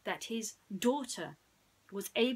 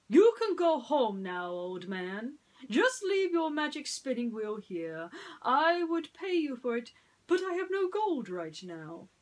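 high, loud pompous prince voice; smug Prince John; 6 semitones higher, 8 dB louder than usual. Acted. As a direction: brush off the old man like a spoiled royal.

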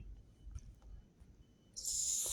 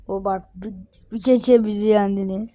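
usual speaking voice

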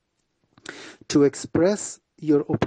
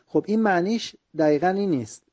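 usual speaking voice

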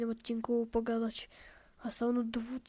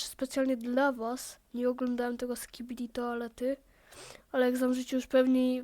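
ordinary talk, nothing marked

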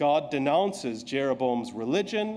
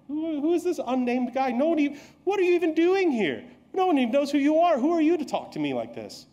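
indignant king; 11 semitones higher than usual. Acted. Preacher voicing the offended king Jeroboam, higher and indignant. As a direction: bristle like an insulted king.

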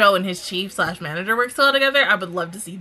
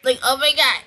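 ordinary talk

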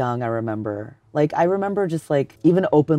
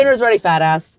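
calling someone home; 6 semitones higher, 6 dB louder than usual. Retold or imitated. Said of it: "imitating the mom calling".

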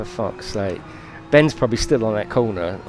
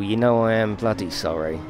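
ordinary delivery

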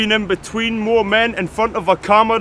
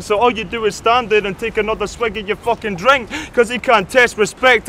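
ordinary delivery

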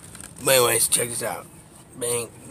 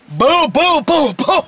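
excited, high-pitched, loud mock punch noises boom boom; punch sounds; 13 semitones higher, 8 dB louder, much slower than usual. Retelling the big guy's punches, loud excited mock punch sounds. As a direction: punch it out, each boom higher and louder. Mimicking punches landing in fight story.

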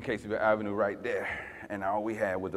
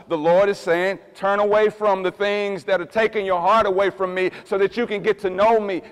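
full-force preaching; 8 semitones higher, 10 dB louder than usual. Acted. Pastor pleading loudly with the congregation to turn. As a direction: plead them to turn back.

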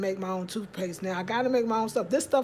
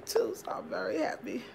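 happy whisper; 3 semitones higher, 5 dB quieter than usual. Real, not acted. Pleased aside about clean house.

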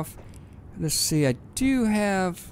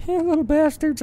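much higher, louder gleeful cheer; delighted cheer; 12 semitones higher, 4 dB louder than usual. Real, not acted. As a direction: cheer the little machines on. Watching drones work.

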